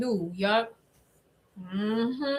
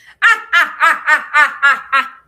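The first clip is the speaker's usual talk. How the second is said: cackling like a wicked witch; a trial run at a villain cackle; evil witch laugh; 5 semitones higher, 10 dB louder than usual. Pretend. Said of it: "Let me do my evil witch laugh".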